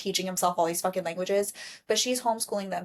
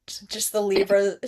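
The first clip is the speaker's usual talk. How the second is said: usual speaking voice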